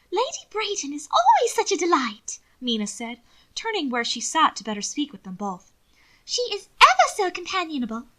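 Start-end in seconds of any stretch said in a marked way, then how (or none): 0.1-2.4 high-pitched fussy society lady, louder; affected lady. A narrator's reading. 6.2-8.1 high-pitched fussy society lady, louder; affected lady. A narrator's reading.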